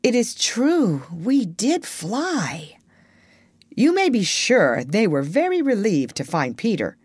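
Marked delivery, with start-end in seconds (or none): 0.0-2.8 slow, drawn-out, higher drowsy wonder; sleepy mutter. A narrator's reading. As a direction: murmur it slowly, half awake. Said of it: "rub his eyes and mutter".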